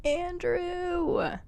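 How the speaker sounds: a high, yearning call of his name; longing sigh. Acted. Pining for the actor.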